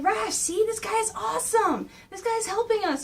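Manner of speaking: put-on high, enthusiastic kid brother; eager Mikey. Mikey persuading Raph about their new ally, high and eager. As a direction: pitch it up, keen to win him over. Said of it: "Mikey's going like".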